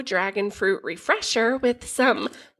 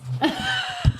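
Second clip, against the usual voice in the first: sudden squealing laugh; high burst of laughter. Real, not acted. Laughs at misheard word.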